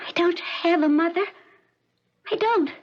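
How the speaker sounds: put-on high, small lost-girl voice; childlike voice. Withdrawn patient answering her visitor in a high childlike voice. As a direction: speak like a frightened little girl.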